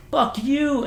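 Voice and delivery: high-pitched mock insult; retold drunken defiance. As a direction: act out a drunk cursing his chief. Drunk insulting the chief, acted.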